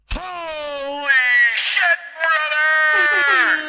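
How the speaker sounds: huge, drawn-out high-pitched holler; hyped shout. Real, not acted. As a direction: bellow it in amazed celebration. Hyped reaction to big donation.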